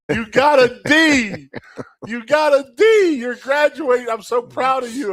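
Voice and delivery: high, loud whooping congratulations; ecstatic coach yelling. Retold or imitated. Strength coach runs at the player, shouting with joy. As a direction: scream it like the proudest coach alive. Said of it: "He goes".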